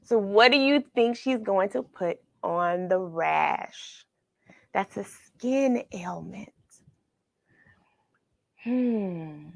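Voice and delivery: plain reading voice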